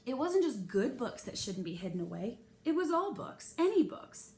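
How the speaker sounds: usual speaking voice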